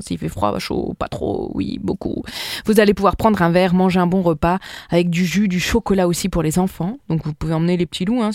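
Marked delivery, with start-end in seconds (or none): 0.0-2.2 hushed aside; whispering. Real, not acted.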